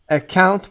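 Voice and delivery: a hard K in "Account". Shown as a demonstration. Teacher shows students the C in "Account" read as a hard K. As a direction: say "Account" with a K sound for the C. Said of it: "با صدای ک خونده شده سی".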